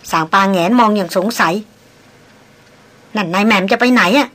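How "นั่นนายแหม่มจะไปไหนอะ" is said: puzzled question. Acted. Servant puzzled asking mistress.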